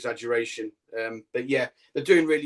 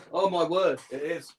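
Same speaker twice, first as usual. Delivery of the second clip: surprised; 5 semitones higher than usual. Real, not acted. Spotting a spider behind him.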